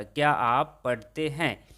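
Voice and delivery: normal talking voice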